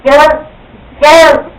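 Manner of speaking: high, loud impatient shout of sir; rude attendant yelling. Retold or imitated. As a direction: bark at the passenger like an angry flight attendant. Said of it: "she was like".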